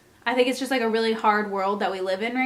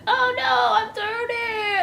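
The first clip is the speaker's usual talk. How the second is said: mock wail; 12 semitones higher, slower than usual. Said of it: "I'm gonna be like".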